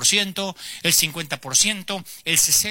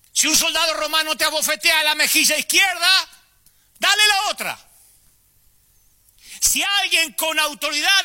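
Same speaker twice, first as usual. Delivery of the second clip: Jesus preaching on the mountain; 8 semitones higher than usual. Acted. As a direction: project it to the last person on the hillside. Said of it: "fuerte para que lo escuchara el último". (Jesús predicando a gritos en la montaña)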